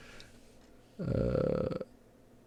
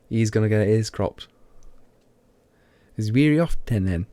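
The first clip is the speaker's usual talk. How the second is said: broad local British dialect, a bit louder; own regional accent; 5 dB louder than usual. Shown as a demonstration. Streamer demonstrating their accent to viewers, thick dialect. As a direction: sound out phonetic dialect spellings loudly. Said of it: "let's see if I can get my accent".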